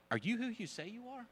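high-pitched, tentative questioning; doubtful question. Acted. John the Baptist questions his cousin Jesus, doubtful. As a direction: ask it carefully, voice lifting high.

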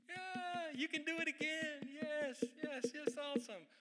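high, airy mock cheering; hollow sideline cheer. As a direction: cheer from the side without ever stepping in.

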